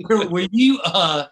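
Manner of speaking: laughing it out while asking. Real, not acted. Host laughing after shared joke.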